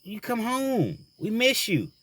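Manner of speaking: put-on high pleading child voice; imitating his little girl, high and pleading; little daughter voice. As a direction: play his three-year-old begging him. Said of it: "And she was like".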